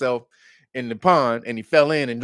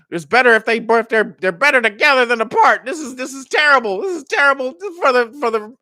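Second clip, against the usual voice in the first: high, louder whining voice; mock panicked insiders; 9 semitones higher, 4 dB louder than usual. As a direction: fret dramatically about the split. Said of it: "they were like".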